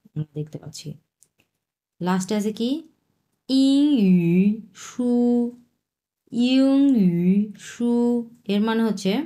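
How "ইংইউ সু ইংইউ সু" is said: ইংইউ sounded as plain "ing", high and drawn out; misreading ইংইউ as "ing"; wrong reading. Shown as a demonstration. Teacher shows students the wrong way to read ইংইউ. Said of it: "এটার উচ্চারণ কিন্তু ইং হবে না".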